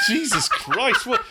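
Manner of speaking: high, startled laughing shout; shocked laughing cry. Real, not acted. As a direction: blurt it out in shock. Reacting to smelling salts.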